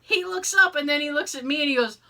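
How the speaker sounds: usual speaking voice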